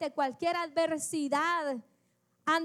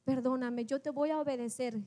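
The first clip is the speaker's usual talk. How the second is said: quiet contrite prayer; 5 semitones lower, 5 dB quieter than usual. Retold or imitated. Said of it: "Me desperté".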